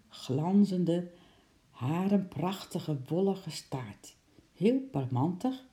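usual speaking voice

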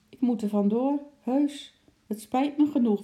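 high little animal voice; squirrel voice. A narrator's reading. Squirrel explaining he must leave, high-voiced. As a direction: make excuses in a high squirrel voice. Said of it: "Alsof hij wilde zeggen".

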